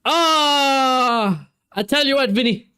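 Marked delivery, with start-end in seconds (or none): 0.1-1.5 real thrill, rising to a squeal; excited shout. Real, not acted. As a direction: yelp at the near miss. Near goal by Vinicius.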